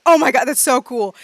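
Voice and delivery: loud, excited fan cry; screaming crowd. Acted. Game master voices students cheering the dragon, loud excited shout. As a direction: imitate a student losing their mind. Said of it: "and start screaming".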